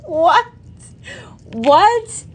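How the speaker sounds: high, loud, laughing "what"; stunned and cracking up at once; laughing disbelief. Retold or imitated. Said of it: "I was like".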